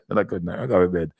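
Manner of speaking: chuckling through the words; laughing. Real, not acted. Vlogger amused at his own remark, laughing. Laughing while repeating himself.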